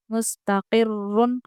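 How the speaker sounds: slow मुस्तकिर्रुन with a doubled, full ra; heavy ra recitation. Shown as a demonstration. Teacher reciting मुस्तकिर्रुन slowly with a heavy ra. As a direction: draw out मुस्तकिर्रुन, ra heavy and held. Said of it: "रॉ पुर पढ़ेंगे".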